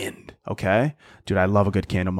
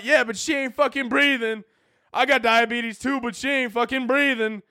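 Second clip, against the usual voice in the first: mock clueless guest; 11 semitones higher than usual. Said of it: "You're like".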